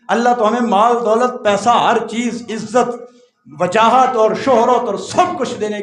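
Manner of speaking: high, loud sermon delivery; preaching at full force. Acted. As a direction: thunder the rich man's words to the hall. Exhorts congregation. (پوری قوت سے وعظ)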